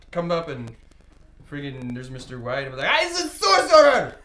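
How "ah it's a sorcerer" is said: high, loud amazed exclamation; excited yell. Acted. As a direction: yell it out in astonishment. Character exclaims on seeing a friend.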